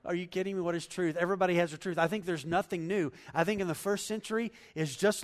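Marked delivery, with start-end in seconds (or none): 0.1-0.6 cynical scoff. Retold or imitated. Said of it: "Pilate, I think, is a cynic". 0.6-2.0 mocking, dismissive shrug of a question; cynical Pilate. Preacher imitating cynical Pilate scoffing at Jesus. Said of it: "Pilate, I think, is a cynic".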